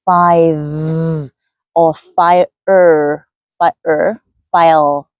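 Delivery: five and fire with the final sounds swallowed; dropped endings. Shown as a demonstration. Teacher imitating students who drop final sounds. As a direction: mimic a Vietnamese speaker dropping the last sound. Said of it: "making those sounds at the end of the words".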